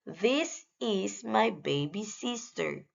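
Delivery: ordinary talk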